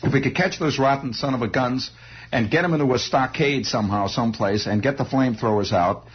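usual speaking voice

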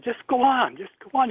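high, strained plea to be left alone; choked brush-off. Retold or imitated. To his younger brother at the window, a pained high brush-off. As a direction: push him away while holding back tears.

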